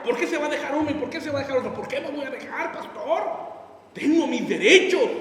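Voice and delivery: mock indignant husband, half laughing. Husband protesting to the pastor, indignant. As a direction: play the husband who won't give in.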